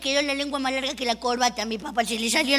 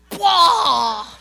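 disgusted cry; 2 semitones higher, 7 dB louder than usual. Acted. Reacting to a foul stench.

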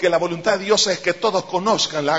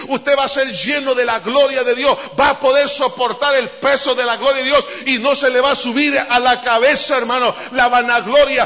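louder, climbing preacher cry; rising preaching; 3 semitones higher, 5 dB louder than usual. Acted. Pastor promising the glory of God, building louder. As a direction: drive the promise home with fire.

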